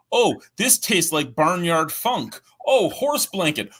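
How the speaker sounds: high, drawn-out imitation of tasters; mock tasting enthusiast. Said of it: "when everybody's like".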